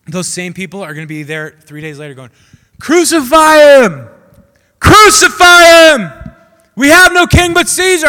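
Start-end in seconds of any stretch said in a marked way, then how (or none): 2.8-8.1 slow, high furious mob yell; crowd screaming. Retold or imitated. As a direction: scream it like a crowd demanding death. Crowd turning, screaming for death.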